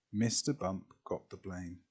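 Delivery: plain reading voice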